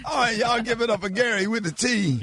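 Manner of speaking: high, loud radio host hype; calling for applause. Real, not acted. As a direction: whip up the crowd for a guest.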